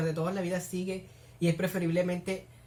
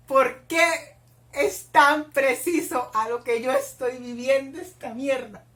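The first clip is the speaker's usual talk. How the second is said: ordinary delivery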